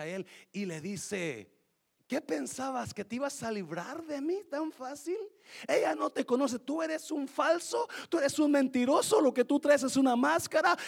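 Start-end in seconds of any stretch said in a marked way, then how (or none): 2.1-10.8 high, loud taunting ex calling him a fake; an ex shouting him down in the street; angry ex. Ex woman loudly exposing the man, high and mocking.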